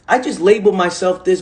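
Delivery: normal talking voice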